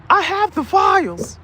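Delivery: high, slow hammed-up proclamation; mock announcement. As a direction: announce "I have the files" high and drawn out. Voicing the shirt's speaker.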